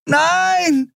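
very high desperate cry; piercing protest; scream. A narrator's reading. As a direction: scream in refusal. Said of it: "schrie Andriel".

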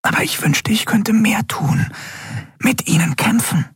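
high, thin whisper. A narrator's reading. Miko wishing he could fight alongside his captain, whispering. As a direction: whisper the wish in a small high voice. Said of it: "flüsterte er".

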